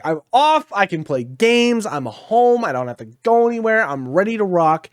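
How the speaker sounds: retold giddy excitement; excited. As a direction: bounce with day-off glee. Retelling his excited day off.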